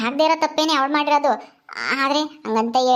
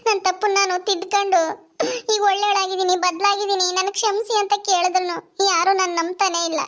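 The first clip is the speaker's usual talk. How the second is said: tearful pleading; 6 semitones higher than usual. Acted. Sister pleads for forgiveness, weeping.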